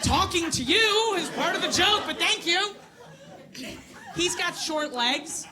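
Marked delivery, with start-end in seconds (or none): 0.0-2.7 loud, high laughing comeback; loud retort. Real, not acted. Comic answers a front-row heckler, loud and laughing. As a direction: fire it back at the heckler, laughing.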